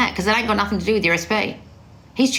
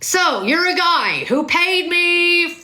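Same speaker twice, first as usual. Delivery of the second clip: Wes Watson; 8 semitones higher than usual. Retold or imitated. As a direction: holler it in a high, stretched-out tirade. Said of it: "He was like that".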